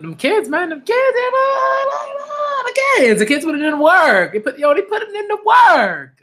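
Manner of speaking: hyped up. Real, not acted. Reader hyped about the manga kids, high excited shouting.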